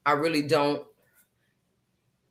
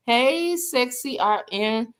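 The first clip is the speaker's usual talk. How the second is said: higher, breathy-flirty hello to a friend; sultry greeting voice; 7 semitones higher, slower than usual. Pretend. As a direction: greet her like a flirty crooner. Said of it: "I gotta talk like that".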